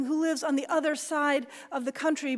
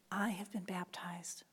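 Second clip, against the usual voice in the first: whispered; 4 semitones lower, 10 dB quieter than usual. Retold or imitated. Translation of Luther's words.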